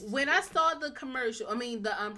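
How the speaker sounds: ordinary delivery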